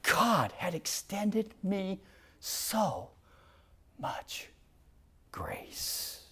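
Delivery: fading to a whisper, choked up; overwhelmed, hushed and tearful. Real, not acted. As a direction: let the voice break into a whisper of gratitude. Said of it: "I started to tear up".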